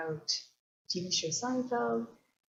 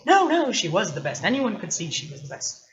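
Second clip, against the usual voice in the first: loud mock-indignant burst, easing off; indignant knight; 7 dB louder than usual. Knight defending his wife to the poet, indignant. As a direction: protest hotly on the knight's behalf, lightly acted. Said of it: "indignant and is like".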